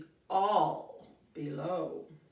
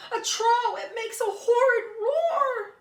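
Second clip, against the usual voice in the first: scared storytelling; 13 semitones higher, 9 dB louder than usual. Acted. Narrating the troll fright.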